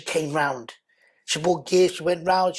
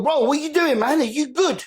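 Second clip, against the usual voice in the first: raised concerned voice; 8 semitones higher, 4 dB louder than usual. Retold or imitated. Retold call, raised voice.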